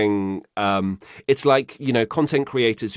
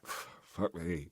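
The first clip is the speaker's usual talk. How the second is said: very quiet, slightly lower aside; deadpan cat reaction; 3 semitones lower, 16 dB quieter than usual. Retold or imitated. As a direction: mutter it low as the unimpressed cat. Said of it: "she's just like".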